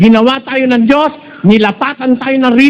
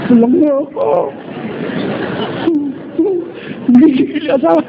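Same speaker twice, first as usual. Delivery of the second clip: mock crying wife; 4 semitones higher, 3 dB quieter than usual. Wife's mock crying lament.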